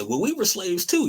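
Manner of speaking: high mocking voice of Irish claimants; mocking whine. Mocking Irish people who claim they were slaves too in a high whine. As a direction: squeak it out like a whiny excuse. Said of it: "Irish people always say".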